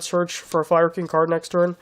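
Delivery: ordinary talk, nothing marked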